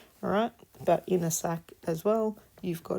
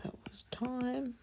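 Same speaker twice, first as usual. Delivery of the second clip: "That was time" breathed softly, higher, under the breath; whispered to herself; 6 semitones higher, 7 dB quieter than usual. Real, not acted. Teacher writing on the board, repeating "That was time" in a whisper.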